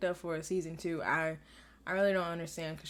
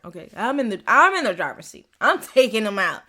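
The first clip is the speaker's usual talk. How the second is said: louder, pumped-up declaration; fired-up takeover; 9 dB louder than usual. Retold or imitated. Maya voiced claiming the driver's seat, pumped and loud. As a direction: announce it like you're seizing control.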